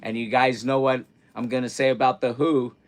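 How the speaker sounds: usual speaking voice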